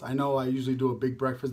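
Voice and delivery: usual speaking voice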